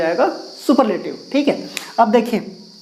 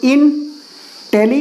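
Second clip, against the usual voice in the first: slow, syllable by syllable: intelligent as इन-टे-लि-जेंट; split into syllables; 3 semitones higher, much slower than usual. Shown as a demonstration. Teacher counting syllables for the class, slow and split. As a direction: stretch intelligent into its three parts. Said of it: "तीन खंड हो गए".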